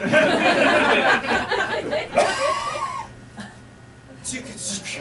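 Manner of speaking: high-pitched laughing shriek; big uncontrolled burst of laughter; loud shrieking laugh. Real, not acted. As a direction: let out a long, high cackle that tails off. Host lets loose, then feels better.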